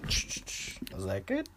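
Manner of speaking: muffled, quiet and distant; speaking through a covered mic. Real, not acted. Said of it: "you sound, like, super muffled".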